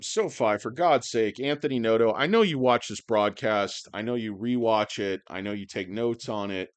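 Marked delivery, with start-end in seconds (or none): none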